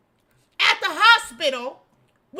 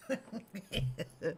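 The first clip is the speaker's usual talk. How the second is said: 1 suppressed laugh; 11 semitones lower, 15 dB quieter than usual. Real, not acted. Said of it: "I'm trying not to laugh".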